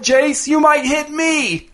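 high, slow choked cry; strangled plea. A narrator's reading. Dez begs his brother to hold fire, slow high choked cry. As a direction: beg while being held. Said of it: "his voice strangled".

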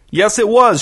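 high, exasperated shout; shouted retort. A narrator's reading. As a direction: shout back in protest. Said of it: "shouted Irvin".

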